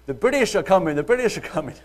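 loud, high-pitched town-crier shout. Acted. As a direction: cry out an urgent warning like Paul Revere.

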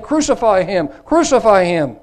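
high, loud repeated demand; crowd crying out. A narrator's reading. Crowd shouts down Pilate, crying out insistently. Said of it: "But they cried".

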